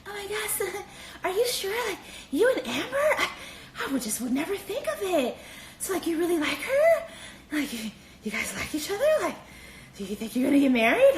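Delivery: flirty put-on voice. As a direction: gush at him in a sugary high voice. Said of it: "her pussy service voice".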